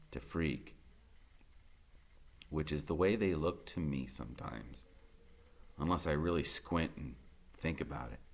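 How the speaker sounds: usual speaking voice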